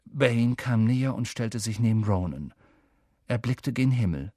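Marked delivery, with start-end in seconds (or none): none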